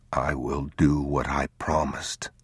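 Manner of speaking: slow, soft whispered vow. Acted. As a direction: whisper a promise to a sleeping child. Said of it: "he whispered".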